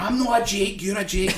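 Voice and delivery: put-on playful childish comeback; panto retort. Manager hamming it up to booing kids. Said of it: "And I'm like".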